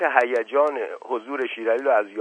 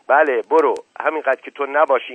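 loud, higher exasperated yell; shouting; 4 semitones higher, 8 dB louder than usual. A narrator's reading. Asadollah sending the son for the doctor, loud yell. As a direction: bark at him to go.

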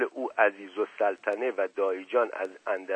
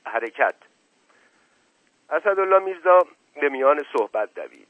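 usual speaking voice

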